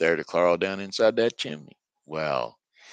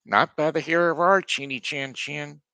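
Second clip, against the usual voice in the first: higher, louder pig voices; defiant little pigs; 7 semitones higher, 4 dB louder than usual. Acted. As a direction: squeal it back in defiance. Pigs refuse the wolf together.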